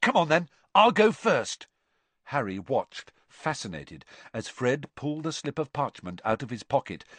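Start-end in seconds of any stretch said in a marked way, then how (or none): 0.0-1.7 high excited question; bursting with excitement. A narrator's reading. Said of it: "quivering with excitement".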